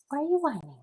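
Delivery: high, soft cooing question; pet talk. Real, not acted. Talking to the whining pet.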